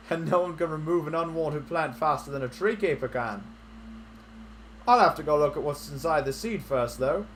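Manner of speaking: mild folksy character read; lightly voiced villager. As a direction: keep the old villager going.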